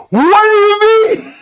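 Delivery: high, loud, slow wail; outraged shriek. Real, not acted. Reacting to jibe about British people, outraged. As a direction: shriek it in drawn-out disbelief.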